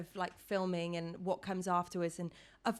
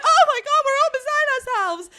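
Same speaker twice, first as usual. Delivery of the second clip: high, loud, squealing excited mum; mum's overjoyed shriek; 17 dB louder than usual. Retold or imitated. Friend's mum shrieking the good news down the phone. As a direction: shriek it like a mum who cannot hold it in. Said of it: "She was like".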